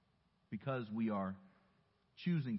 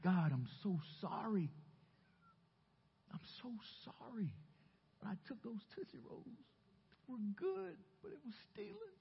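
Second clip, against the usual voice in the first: imitated weeping; 13 semitones higher, 4 dB quieter than usual. Eight-year-old weeping to God at the altar, mock tearful. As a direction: squeak it out like a sobbing child, hammed-up. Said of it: "I would weep my eyes out".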